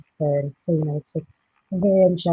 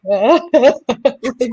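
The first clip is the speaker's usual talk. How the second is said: slow, high laughing sob; laugh-cry through the words; ugly crying; 7 semitones higher, 8 dB louder than usual. Retold or imitated. Remembering her first night watching the show, laugh-crying. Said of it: "just ugly crying".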